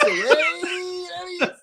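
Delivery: high, slow, cried-out cheer. Acted. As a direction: call it out like a proud cheer. Cheering Dave's pronunciation.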